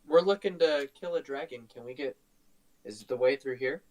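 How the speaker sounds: usual speaking voice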